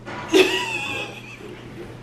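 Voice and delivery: very high burst of laughter; high squealing laugh; shrieking laugh. Real, not acted.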